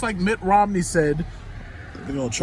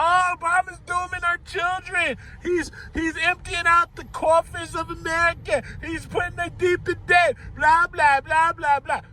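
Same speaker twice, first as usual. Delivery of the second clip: much higher, whiny mock wail with a fake sob; mocking Fox News pundit; 12 semitones higher than usual. Mocking Fox News pundits for viewers, a high whiny fake-crying wail. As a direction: ham up a hysterical pundit crying over Obama's spending. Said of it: "Fox News idiots ... would be screaming about".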